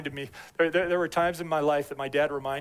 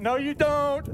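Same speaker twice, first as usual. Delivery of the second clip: higher, louder comeback, playing his wife; wife calling out her rushing husband; wife's retort; 11 semitones higher, 6 dB louder than usual. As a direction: shoot it back as the unconvinced wife. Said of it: "She's like".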